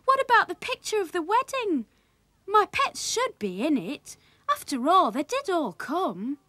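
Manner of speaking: put-on high, protesting boy; young Willie's voice. A narrator's reading. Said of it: "said Willie".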